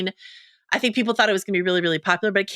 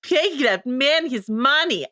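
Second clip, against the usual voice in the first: slow, heavy mock Russian accent, dragging each word; Malkovich Rounders Russian accent; 5 semitones higher, much slower than usual. Podcaster imitating Malkovich in Rounders, slow mock Russian accent. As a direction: drawl it out like a Russian card-shark villain. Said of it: "the Malkovich Rounders accent".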